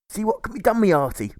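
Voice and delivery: put-on a hearty old sailor type brushing him off; jailer's gruff reply. A narrator's reading. The one-legged jailer answering Alfred loudly. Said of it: "the jailer replies with mundanity".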